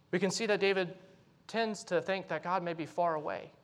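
normal talking voice

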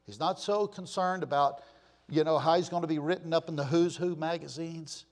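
ordinary delivery

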